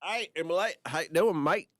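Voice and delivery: put-on Australian accent. Greeting Australian listener Emily.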